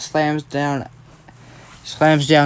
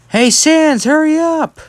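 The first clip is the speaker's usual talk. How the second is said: high, raised holler; calling shout; 13 semitones higher, 5 dB louder, slower than usual. Retold or imitated. Kitsuki shouts to Deku to hurry, high calling shout. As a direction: call out across a distance, pitch way up. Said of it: "Kitsuki shouts to Deku".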